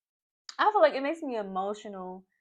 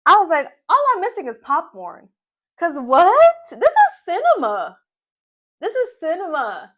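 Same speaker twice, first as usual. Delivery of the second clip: loud, high-pitched delighted outburst; gleeful excitement; 8 semitones higher, 13 dB louder than usual. Retold or imitated. Viewer raving that the fight is cinema, gleefully excited. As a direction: shout it like a thrilled spectator. Gleefully relives watching the drama.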